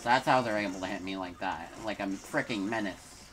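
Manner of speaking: ordinary delivery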